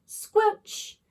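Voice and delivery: high, wet squelch; squelch sound. A narrator's reading. Narrator voicing the slime's squelch sound.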